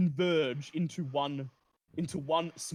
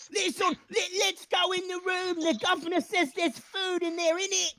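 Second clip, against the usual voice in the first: rat rallying shout; 14 semitones higher than usual. Acted. Rat Radicus rousing the swarm, high call. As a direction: whip up the swarm, voice pitched high. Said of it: "Radicus shouts".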